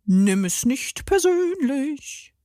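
fluting. A narrator's reading. Carlos sweetly consoles Benny, fluting. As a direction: coo it sweetly up high, slowly. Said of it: "flötete Carlos".

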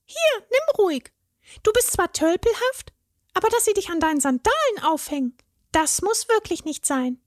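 very high fairy voice, kind; a fairy handing over a gift; generous fairy. A narrator's reading. The fairy gives the troll a leg, high and kind.

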